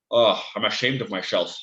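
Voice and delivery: hammed-up Scottish accent, slushy s's; Sean Connery impression. Telling a Bond joke about fallen shelves, mock Scottish accent. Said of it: "very good accent".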